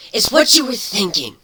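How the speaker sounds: high, loud snarl of the changeling; an angry changeling's snarl. Acted. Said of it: "Kuno snarled".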